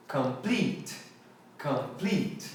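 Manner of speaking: complete said com-PLEET, long ee; English complete. Shown as a demonstration. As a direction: rhyme complete with delete. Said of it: "essa palavra aqui rima com essa aqui". (complete com i longo no final, complít)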